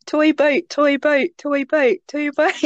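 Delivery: "toy boat" rattled off over and over; tongue twister. Shown as a demonstration. Trying a friend's tongue twister, repetitive chant. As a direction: repeat "toy boat" in an even run until it slips.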